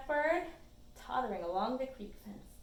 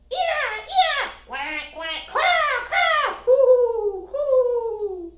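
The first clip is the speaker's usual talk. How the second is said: loud gull, duck, crow and dove calls in a row; loud squawks sliding into high coos; mockingbird mimicry; 12 semitones higher, 17 dB louder, much slower than usual. A narrator's reading. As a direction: run through bird calls like a mockingbird. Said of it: "She copies all of the songs and calls".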